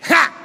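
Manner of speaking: single short "ha" from the throat; one abrupt imitated tycoon's laugh; guttural Australian billionaire laugh. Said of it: "gluttural fucking laugh".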